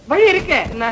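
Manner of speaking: ordinary talk, nothing marked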